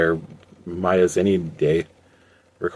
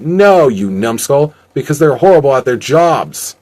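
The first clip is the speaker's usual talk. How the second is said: loud, higher-pitched rebuke; exasperated scolding; 6 semitones higher, 7 dB louder than usual. A narrator's reading. Emmanuel corrects his henchman, loudly exasperated. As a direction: scold a dim underling loudly.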